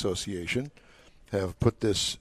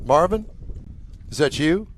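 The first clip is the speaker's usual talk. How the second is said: raised, questioning call to someone at a distance; 5 semitones higher than usual. Real, not acted. Host hailing a man in the audience, calling out. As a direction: call out to check who it is.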